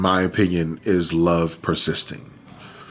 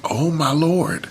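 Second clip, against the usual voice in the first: stunned; 9 semitones higher, slower than usual. Retold or imitated. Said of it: "I'm like".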